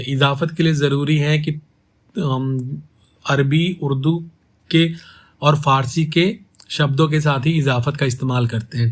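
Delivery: ordinary talk, nothing marked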